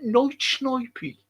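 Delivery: slow, high mock Brummie; sing-song Brummie put-on voice; Brummie accent. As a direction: stretch it out in a Birmingham accent. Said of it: "that's a, a Brummie accent".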